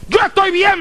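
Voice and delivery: mock indignant young man talking loudly; defiant loud retort. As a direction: throw it back defensively, out loud. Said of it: "él me habló en voz alta".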